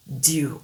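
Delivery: dew avec un D doux; English dew. Shown as a demonstration. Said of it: "le D en anglais, il est plus doux".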